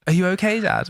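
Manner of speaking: lightly imitated worried child; concerned child. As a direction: play a kid checking on Dad. Child asks father again.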